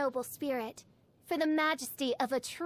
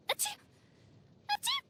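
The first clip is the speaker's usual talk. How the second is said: sneeze; faster than usual. Real, not acted. Sneezing fits in the cold.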